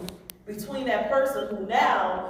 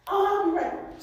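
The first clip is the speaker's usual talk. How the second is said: dismissive driver; 12 semitones higher than usual. Retold or imitated. Driver brushing off, high.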